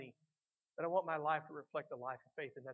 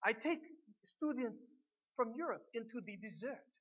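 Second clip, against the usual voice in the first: imitated German accent, pitched up; higher-voiced German-accented English; 7 semitones higher, slower than usual. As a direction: put on a German priest's accent with relish. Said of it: "he said with his great accent".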